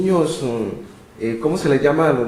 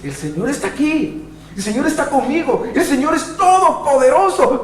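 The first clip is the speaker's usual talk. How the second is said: rising, growing louder and higher to a triumphant call; bold declaration; 11 semitones higher, 3 dB louder than usual. Pretend. Believer rising from a bad day, declaring triumphantly. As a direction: build from confident to triumphant proclamation. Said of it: "Te levantas y dices".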